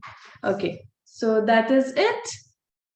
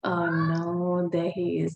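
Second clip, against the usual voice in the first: ordinary talk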